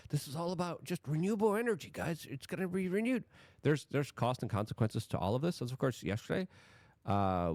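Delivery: ordinary delivery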